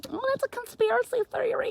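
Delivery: very high, silly mocking voice; squeaky mock dismissal; dismissive people. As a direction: mimic scoffers in a squeaky voice. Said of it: "people just".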